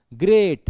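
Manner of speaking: "Great" hit hard and loud; stressed syllable. Shown as a demonstration. Teacher shows the class a stressed syllable, "great" punched loudly. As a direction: put full force on "great". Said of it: "इस पे जोर लग रहा है".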